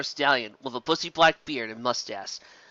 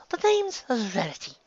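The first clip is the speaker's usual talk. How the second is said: slow, higher, prim correction; Rarity's voice; 8 semitones higher, slower than usual. Acted. Rarity primly corrects Songbreeze. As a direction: set the record straight.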